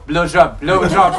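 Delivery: mock French man moaning the word with a laugh; French accent. Mimicking the French guy for the podcast in a French accent. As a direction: ham up a French guy's voice, laughing. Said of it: "hear the French guy go".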